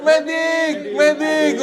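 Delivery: jauh lebih tinggi, berteriak memanggil petugas medis; teriak medik. Pretend. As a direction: panggil medis seperti prajurit tertembak. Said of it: "gitu, teriak". (prajurit tertembak memanggil medis, berteriak tinggi)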